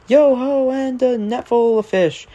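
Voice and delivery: put-on high hearty old sea dog calling out; sing-song shanty refrain, hearty; salty sailor voice. Narrator voicing Salty the dock diesel, hearty old sailor.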